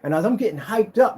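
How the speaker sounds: usual speaking voice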